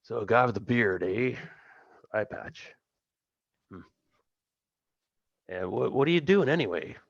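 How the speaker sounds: lightly voiced tipsy rogue; a touch of drunken slur. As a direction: play him a bit lit up, pitch wandering. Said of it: "he's a bit tipsy".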